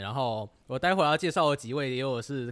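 ordinary talk, nothing marked

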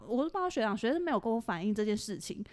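ordinary talk, nothing marked

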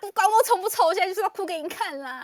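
hammed-up sulky insistence; mock defiant whine. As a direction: throw a daughter's tantrum at dad. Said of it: "你跟他说".

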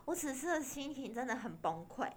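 ordinary talk, nothing marked

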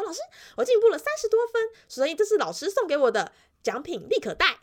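put-on high, fast, loud kid's boast; eager boy showing off. Pupil boasts to teacher about his score, high fast eager voice. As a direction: play the proud pupil rushing out his news.

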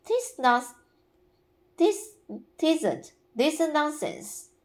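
put-on high, hurt child's protest; injured tone. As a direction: sound wounded at being doubted. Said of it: "in an injured tone".

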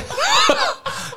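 sudden high-pitched burst of laughter. Real, not acted. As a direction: let a squeal of laughter out.

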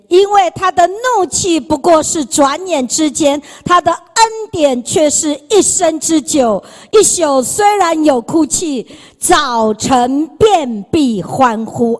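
preaching a psalm aloud; scripture recitation. Acted. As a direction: proclaim the verse with rising fervour. Reciting Psalm thirty loudly.

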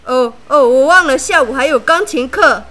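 loud, hurried excuse; flustered excuse. A narrator's reading. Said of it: "她的朋友们看了就吓到了".

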